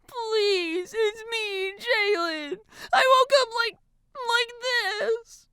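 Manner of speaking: put-on high, tearful girlish plea; begging. A narrator's reading. Transformed girl to her friend, begging. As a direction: plead in a whiny high voice. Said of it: "bringing her hands together to beg".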